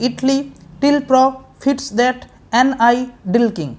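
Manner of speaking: "Italy / till pro / fits that" in short spaced pairs; grouped in twos. Shown as a demonstration. Teacher recapping two per part, "Italy, till pro" foot by foot. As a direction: read two syllables, pause, then the next two. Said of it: "প্রত্যেকটাতে দুটো দুটো করে আছে".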